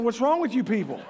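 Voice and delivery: ordinary talk, nothing marked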